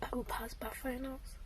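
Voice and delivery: quiet whispered vow; whispered affirmation. Real, not acted. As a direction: whisper it to yourself like a promise. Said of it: "once again, I say".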